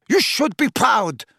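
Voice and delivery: high, cold and cutting; ruthless. A narrator's reading. Bellatrix mocking her sister, ruthless. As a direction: sneer down at the weeping mother. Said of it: "said Bellatrix ruthlessly".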